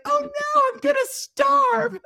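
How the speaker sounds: high, slow, laughing mock wail; hammed-up panic breaking into laughter; mock horror. Acting out her fear of starving, mock horror.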